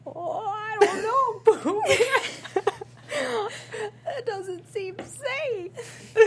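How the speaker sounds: very high, slow, trembling piglet voice. Pretend. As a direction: squeak it timidly like Piglet. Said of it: "Can you do Piglet?".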